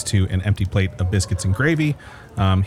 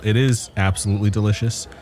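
usual speaking voice

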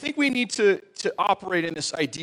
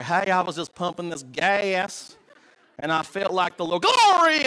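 Southern preacher; 4 dB louder than usual. Acted. Stranger at gas station turned Southern preacher, hammed-up revival voice. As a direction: ham up a Southern preacher, exploding on the last word. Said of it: "turn into a Southern preacher".

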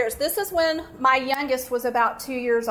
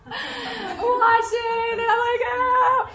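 high-pitched, drawn-out comic wail; put-on bawling, high and stretched; mock wailing cry; 9 semitones higher, much slower than usual. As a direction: wail it out slowly, exaggerated sobbing. Acting out crying in shower.

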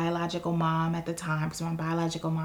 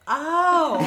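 high, loud mock "oh" of discovery; sudden realisation; 9 semitones higher, 7 dB louder than usual. Student retelling her first syllabus lesson, sudden realisation. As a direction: act out the penny dropping, big and high.

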